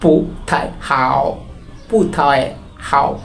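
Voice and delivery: "পু থাই হাও" slowly, syllable by syllable with its tones; tone demonstration. Shown as a demonstration. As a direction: separate "পু", "থাই" and a drawn-out "হাও". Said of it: "তাহলে কিভাবে উচ্চারণ করবো?". ("পু থাই হাও" ধীরে, আলাদা আলাদা টোনে)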